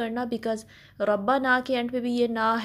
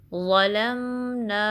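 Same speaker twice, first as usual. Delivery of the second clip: slow, drawn-out chanted recitation; slow tajweed recitation; Quranic recitation; 3 dB louder, much slower than usual. Shown as a demonstration. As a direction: recite it slowly, stretching each sound. Quran recitation demo in lesson.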